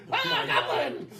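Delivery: very high, loud squeak through a laugh; high squeaky voice. Acted. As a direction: squeal it out like an excited little goblin. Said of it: "Leon's voice get this high and squeaky".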